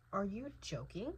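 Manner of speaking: normal talking voice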